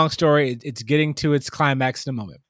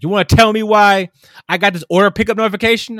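higher, louder, fast demanding challenge; irate customer; 6 semitones higher, 6 dB louder, faster than usual. Retold or imitated. Customer confronting the clerk about the notification, angry and fast. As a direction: demand an answer, fed up.

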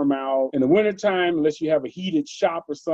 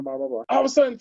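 normal talking voice

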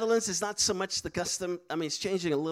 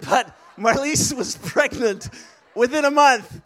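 excited reveal; 4 semitones higher, 7 dB louder, slower than usual. Real, not acted. Punchline of pregnancy joke.

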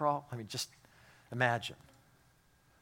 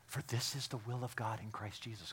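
unvoiced hush of scripture; whispered verse; 2 semitones lower, 10 dB quieter than usual. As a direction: breathe the verse secretly. Quoting verse in barracks story.

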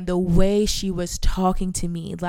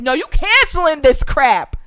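loud, high, slow order; retold heated command; demanding; 6 semitones higher, 7 dB louder than usual. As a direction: insist on it, leaning on each word.

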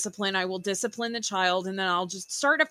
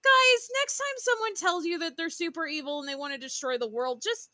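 ordinary delivery

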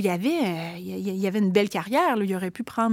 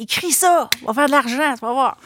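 higher, louder eager pushy salesman tone; brother-in-law's pitch; 3 semitones higher, 5 dB louder than usual. Retold or imitated. Author mimics her brother-in-law urging her to write a thriller, higher and louder. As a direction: sell it like a confident hustler. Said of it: "Bon, il dit".

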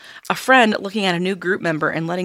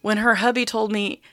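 normal talking voice

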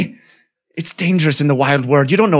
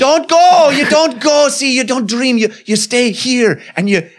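pleading koala elder; 6 semitones higher than usual. Acted.

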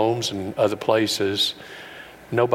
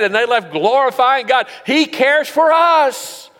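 much higher, louder, slow soaring proclamation; full-force preaching; 6 dB louder, slower than usual. Real, not acted. Preacher proclaiming to the congregation, soaring full-force preaching. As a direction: preach it out, stretching every word.

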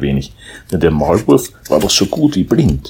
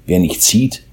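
usual speaking voice

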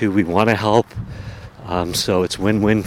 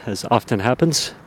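ordinary talk, nothing marked

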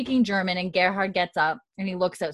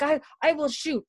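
scared threat; 8 semitones higher than usual. Retold or imitated. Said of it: "Gerhard looks freaked".

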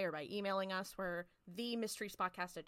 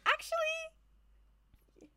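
very high, very slow sing-song; drawn-out high Actually; 6 dB louder, much slower than usual. Retold or imitated.

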